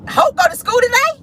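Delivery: high-pitched imitation of a mother asking a child; mock sing-out question to a toddler; put-on high mommy voice; imitating the mother. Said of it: "She said".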